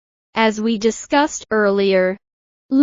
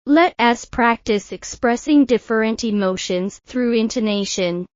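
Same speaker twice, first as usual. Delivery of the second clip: normal talking voice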